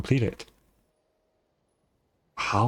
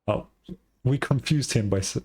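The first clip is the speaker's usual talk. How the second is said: ordinary talk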